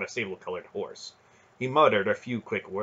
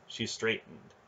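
usual speaking voice

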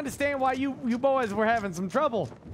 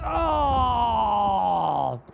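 startled shout; 3 semitones lower, 4 dB louder than usual. Real, not acted. Ship hits the rocks.

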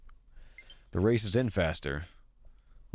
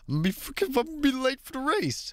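high-pitched frantic worry. Real, not acted. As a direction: panic about being late. Frantic about being late.

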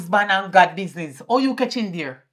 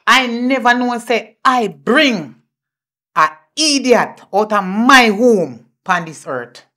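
slow scolding; 5 dB louder, slower than usual. Acted. Mother scolding daughter.

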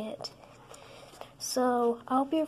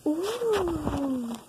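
long, higher, gliding "ooh"; delighted ooh; 4 semitones higher than usual. Real, not acted. Kid watching the cargo fizz, delighted ooh. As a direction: coo in wonder at it. Watching the toy begin fizzing.

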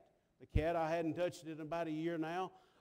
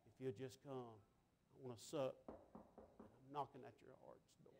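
very soft, low pleading; quiet, low altar-call invitation; hushed appeal; 6 semitones lower, 17 dB quieter than usual. Acted. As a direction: drop to a near-murmur, gently inviting. Gentle appeal after preached climax.

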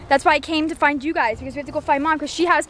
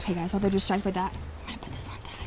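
hushed, quick aside to viewers; secretive whisper; 5 semitones lower, 9 dB quieter, faster than usual. Acted. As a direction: whisper so the others don't overhear. Secret aside behind family's backs.

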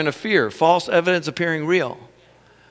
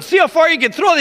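excited dare; 10 semitones higher, 4 dB louder, faster than usual. Retold or imitated. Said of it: "I told my other son".

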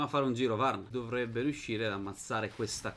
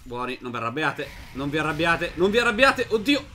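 coaxing calm rising to a high, loud yell; pleading, building to panic; 3 semitones higher, 7 dB louder than usual. Real, not acted. Gamer begs the worshippers, calm then panicking. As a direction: beg them to stay calm as panic takes over.

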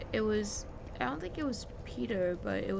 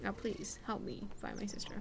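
soft whisper, pleading; whispered plea; 9 dB quieter than usual. Real, not acted. Gamer whispering a plea to find her sister. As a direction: whisper it like a prayer.

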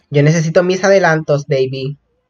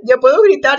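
voz aguda de euforia; entusiasmada; 8 semitones higher than usual. Real, not acted. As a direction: celebra en tono agudo. Celebrates being free to scream. (con euforia, en voz alta y aguda)

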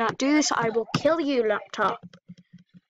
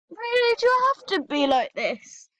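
pitched up, fed-up plea at the machine; you've had it with this laptop, let it show; exasperated scolding; 6 semitones higher than usual. Real, not acted. Scolding her frozen laptop, exasperated and high.

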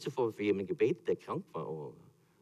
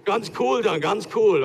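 louder, higher, casual swagger; mock cool; 7 semitones higher, 8 dB louder than usual. Moses after the sea parts, hammed-up nonchalance. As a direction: play it ultra cool.

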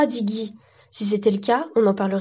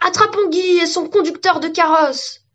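high-pitched mob shout; rally a mob to give chase; raised, eager hunting cry; 8 semitones higher, 4 dB louder than usual. A narrator's reading. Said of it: "les arbres s'écrièrent".

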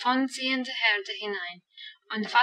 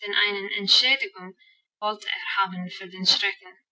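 ordinary delivery, nothing marked

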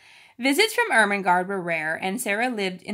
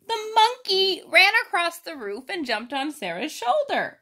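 excited retelling; 8 semitones higher, 3 dB louder than usual. Real, not acted. Excited retelling of monkey jump.

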